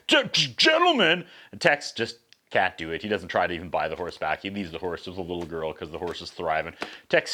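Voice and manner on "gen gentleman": high, slow stammered question; lightly hammed-up stunned stutter; mock stunned stammer. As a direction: stumble over the word in mock disbelief. Acts Tex hearing horse's name.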